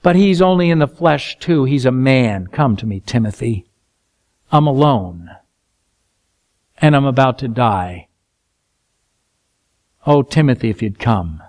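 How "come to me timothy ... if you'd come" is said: put-on old prisoner whispering for his friend; lonely plea. Preacher voices lonely Paul pleading.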